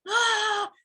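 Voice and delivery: sudden very high angry shout of ah; frustrated outburst cry. Retold or imitated. Raging at a broken phone, mock outburst scream. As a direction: let out a short frantic scream. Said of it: "you're like".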